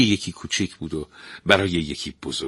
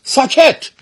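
very loud, very high shout; the prince shouts the stepmother down; shouted silence; 10 dB louder, much slower than usual. Acted. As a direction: bark the order at full volume. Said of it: "شاهزاده فریاد زد".

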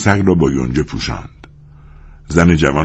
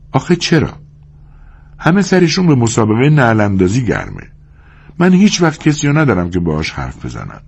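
higher-pitched, complaining woman's voice; lonely young woman; 5 semitones higher than usual. Acted. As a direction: plead for company as the lonely wife.

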